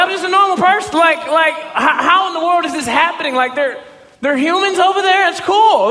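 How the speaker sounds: retelling a thrilled reaction with laughing shouts; excited disbelief. Retold or imitated. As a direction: shout it like you can't believe your luck. Thrilled disbelief at the Celtics' reply.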